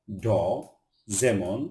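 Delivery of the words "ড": ড as a lone "daw" sound. Shown as a demonstration. Teacher demonstrating the letter D, its spoken sound. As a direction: give ড as D's sound, not its name. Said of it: "ডি এর উচ্চারণ".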